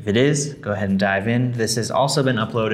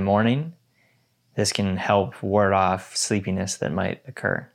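guided meditation. Acted. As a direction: guide them gently into stillness.

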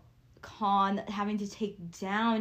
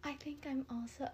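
whisper; 5 semitones higher, 9 dB quieter than usual. Real, not acted. Admitting feeling older.

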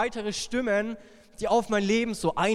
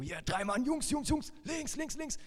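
mock goalkeeper shout; 8 semitones higher, 4 dB quieter than usual. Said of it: "schreit er immer rum".

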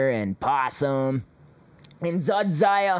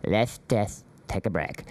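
lower, limp mock politician voice; mocking imitation; 6 semitones lower, 4 dB quieter than usual. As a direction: mimic a droopy, weak-willed speaker. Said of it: "why do they always sound".

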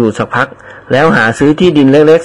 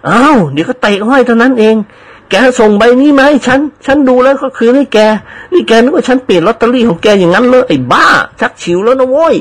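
higher, put-on stern scolding; mock stern; 9 semitones higher than usual. Friend pretends anger at servant's accusation, mock stern scolding. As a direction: feign outrage at being accused. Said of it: "แกล้งทำหน้าขึงขัง".

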